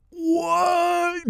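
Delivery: jaw-dropped, voice jumping right up; shocked what. Retold or imitated. Hears he kills the Beast.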